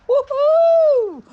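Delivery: very high, loud whoop; excited cheer. Real, not acted. Cheering the New Year with subscribers, a loud high whoop. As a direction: throw out a joyful whoop.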